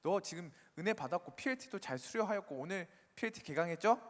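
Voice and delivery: ordinary talk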